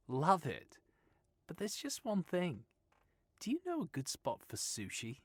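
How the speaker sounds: put-on breezy Australian girl voice; easygoing Australian woman reassuring Stephen; Australian accent. Sylvia speaks.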